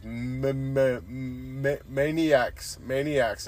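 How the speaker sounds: imitated stammering struggle to get one word out; halting attempt at an English word, mimicked; mustering a word. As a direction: stumble on the first syllable, then land it. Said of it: "he musters this".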